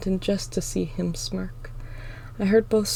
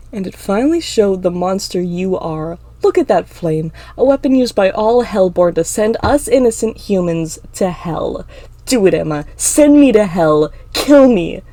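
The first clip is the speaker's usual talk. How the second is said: goading; 8 dB louder than usual. Acted. Clinton dares Emma to use her flame, goading. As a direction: dare them, louder with each demand.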